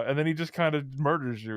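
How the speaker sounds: ordinary talk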